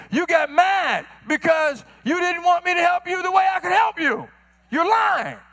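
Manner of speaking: fiery sermon accusation; preaching. Acted. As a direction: call them out from the pulpit. Preaching at full force.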